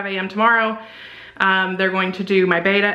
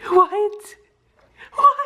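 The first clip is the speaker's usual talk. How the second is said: surprised; 13 semitones higher, 4 dB louder than usual. Real, not acted. Disbelief at test.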